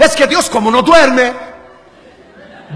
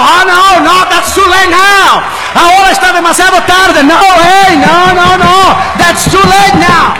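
loud, higher-pitched retort; emphatic loud refusal; 7 semitones higher, 6 dB louder, much slower than usual. Retold or imitated. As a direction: shut down a plea at full preaching volume. Preacher retorts to frightened passenger.

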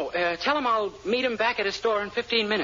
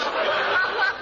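gleeful laugh; 9 semitones higher than usual. Acted. Gloating at Willoughby.

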